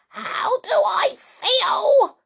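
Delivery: high, loud, slow outraged yell; drawn-out high shriek of outrage; furious cry. A narrator's reading. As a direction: throw the question back in fury. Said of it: "she cried".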